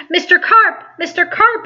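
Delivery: high, loud worried calling out; yelling. Acted. Child calling into the pool for Mister Carp, yelling. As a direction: call him up from the water. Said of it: "I yelled".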